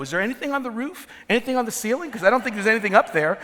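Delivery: put-on high, fast teasing questions to the kids; sarcastic dad asking about the ceiling; mock parent quizzing. Dad teasing eye-rolling kids with high, fast questions. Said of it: "I go".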